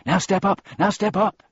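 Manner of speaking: high, loud urging; recommending voice. A narrator's reading. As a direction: coax them up with high, insistent calls. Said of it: "it recommended".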